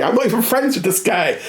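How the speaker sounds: normal talking voice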